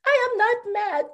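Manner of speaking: high, anguished insistence; anguished cry. Acted. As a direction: lift it high in desperate protest. Insisting she is sane.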